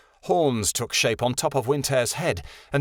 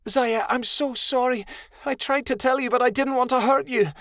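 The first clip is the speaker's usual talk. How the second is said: high, pained and pleading; sorry pouring out in distress; anguished apology; 12 semitones higher than usual. A narrator's reading. Midnight Star apologizes to dying Zaya, anguished.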